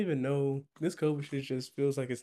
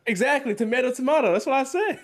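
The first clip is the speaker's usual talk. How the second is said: high, loud gloating; triumphant; 10 semitones higher, 6 dB louder than usual. Real, not acted. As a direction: crow over the win. Triumphant comeback in the argument.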